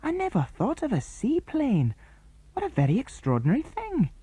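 put-on a boy staring in amazement, pitched high; Andy spotting the seaplane, amazed and high.

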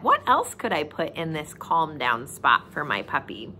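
normal talking voice

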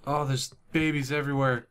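ordinary talk, nothing marked

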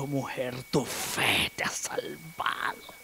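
slow, soft whispered comfort; the Lord's tender hushed words. Acted. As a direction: speak gently to the healed woman. Jesus voiced tenderly to woman.

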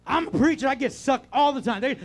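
loud, high, driving preacher's cry; full-force preaching. Acted. Pastor preaching hard to the congregation, full force. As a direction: preach it out at the top of the lungs. Preacher shouts his point.

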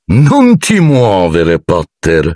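very slow, lazy amused drawl; indolent tone. A narrator's reading. Snape amused at Harry frozen before the snake, indolent. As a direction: savour the moment, unhurried. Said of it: "con tono indolente".

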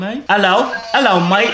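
chirpy put-on British "mate"; British accent. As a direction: try a fake British hello. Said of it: "I think the accent".

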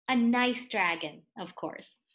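ordinary delivery, nothing marked

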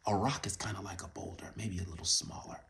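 quick whispered aside; hushed fast explanation. Real, not acted.

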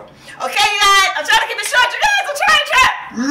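high, loud, hurried laughing sign-off; excited. Real, not acted. Rushed sign-off to viewers.

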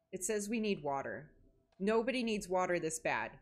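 ordinary talk

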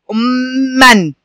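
أمًا drawn out very slowly, doubled م held, ending -an; teacher stretches أمًا very slowly; slow model word. Shown as a demonstration. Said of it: "أول مثال معايا دلوقتي هو كلمة". (نطق أمًا ببطء شديد مع شد الميم)

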